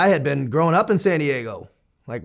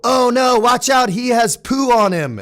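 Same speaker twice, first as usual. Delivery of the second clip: higher, louder mock alarm of a nurse; urgent, raised-voice imitation; retold alarmed warning; 10 semitones higher, 5 dB louder, slower than usual.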